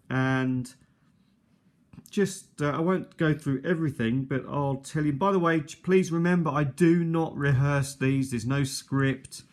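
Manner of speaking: ordinary delivery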